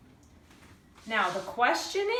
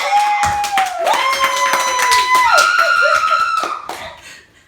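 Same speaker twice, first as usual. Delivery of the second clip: shrieking laugh high above speaking pitch; loud high cackle; 17 dB louder than usual. Acted. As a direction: cackle loudly in mock glee. Mock villain laugh after the no.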